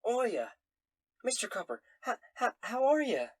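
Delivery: bright high barmaid greeting; loud sassy barmaid calls. Acted. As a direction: call out like a cheery tavern wench. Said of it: "and she says".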